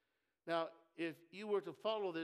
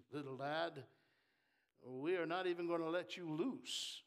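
usual speaking voice

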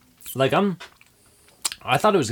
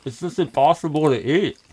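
talking with a burning mouth; spicy heat in the sinuses; mouth burning from spicy food. Real, not acted. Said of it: "it's in the sinuses".

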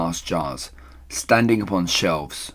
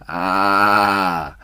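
long, drawn-out "ah" after a gulp; gasped sigh from a fiery drink; satisfied gasp; 4 dB louder than usual. A narrator's reading. Smallest fox after fiery cider, long satisfied gasp. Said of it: "gasped the smallest fox".

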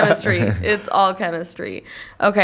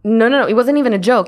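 ordinary talk

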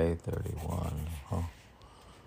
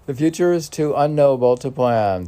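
emphatic poem line; 6 semitones higher, 10 dB louder, faster than usual. Acted. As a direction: declaim it louder and fast.

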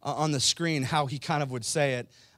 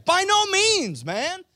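much higher, louder and slow; with more gusto; 10 dB louder, slower than usual. Shown as a demonstration. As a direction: bellow it with full conviction. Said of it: "he would say it with a little more gusto".

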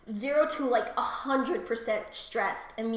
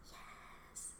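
soft, unvoiced yes; breathy whisper; 25 dB quieter than usual. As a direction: whisper it with pleasure. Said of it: "then I'm like".

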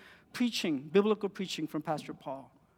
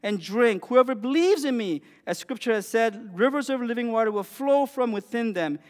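louder, raised call to the crowd; 5 semitones higher, 6 dB louder than usual. Retold or imitated. Jesus calling out at the festival, loud and higher. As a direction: proclaim it across a festival crowd. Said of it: "said in a loud voice".

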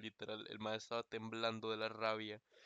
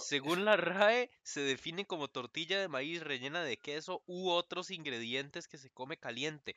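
lectura de definición; 6 semitones higher, 5 dB louder, slower than usual. Shown as a demonstration. Lee la definición de la RAE.

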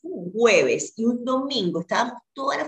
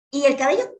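higher, alarmed cry about her hair; panicked question; 8 semitones higher than usual. Retold or imitated. Newly diagnosed patient asking the doctor about hair, alarmed and higher. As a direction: blurt it out in retold panic, pitched up. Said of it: "y dije".

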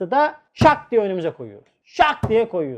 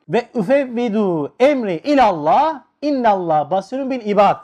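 Quranic recitation. Acted. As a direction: recite the verse with reverence. Ayet okuyor. (yavaş, kıraatle ayet okuma)

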